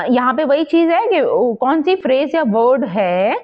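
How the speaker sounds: ordinary talk, nothing marked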